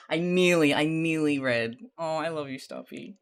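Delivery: usual speaking voice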